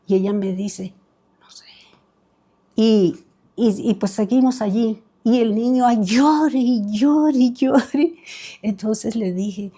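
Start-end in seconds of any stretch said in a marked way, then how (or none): 1.4-1.7 barely audible, voiceless answer; whispered reply. Retold or imitated. Mother answering her friend during mass, whispering. 5.9-8.1 slow, stretched repetition of endless crying; drawn-out retelling. Retold or imitated. Woman retelling how the boy kept crying, drawn out. Mimics the boy's nonstop crying.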